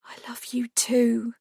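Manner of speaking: whispering. Acted. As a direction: breathe it in a whisper. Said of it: "Only then did I whisper back".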